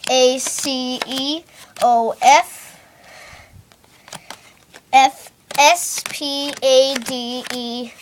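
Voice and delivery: slow letter by letter, each one counted out; spelling it out. Shown as a demonstration. Magician to audience, slowly spelling out each letter. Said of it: "I'll try spelling ace of spades".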